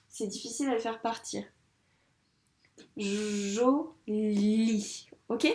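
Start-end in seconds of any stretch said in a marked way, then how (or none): 3.0-5.1 joli stretched long while writing; drawn-out word. Shown as a demonstration. Teacher to pupil, reading back joli slowly. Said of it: "nous avons fini d'écrire le mot joli".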